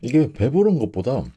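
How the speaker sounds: talking with mouth full; muffled through hand; chewing behind hand. Real, not acted. Seen 1: Hand covers mouth while chewing octopus; hand drops by 1.1.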